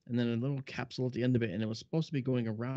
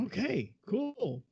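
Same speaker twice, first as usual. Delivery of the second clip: slow, drawn-out okay, cool; laid-back slow acceptance; 11 semitones higher, much slower than usual. Retold or imitated. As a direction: play it cool, stretching the words. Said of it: "I went".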